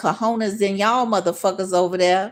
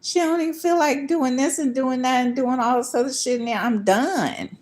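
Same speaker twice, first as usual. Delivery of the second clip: imitating him, pitched higher, exasperated; mock voice of him; 4 semitones higher than usual. Imitating the man complaining about her, pitched higher. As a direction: act out his whiny complaint, higher. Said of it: "He like".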